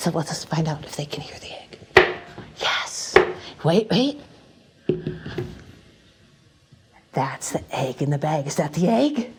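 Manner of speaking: soft hush to the audience; stage whisper. Acted. Performer whispering to the audience about the egg. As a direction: let the crowd in on a secret.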